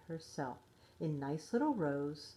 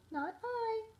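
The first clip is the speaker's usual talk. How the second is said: much higher mewing cat voice; meowing cat. A narrator's reading. Cat turns down the hen, in high meowing voice. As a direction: mew it like a kitten saying no. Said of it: "The cat meowed".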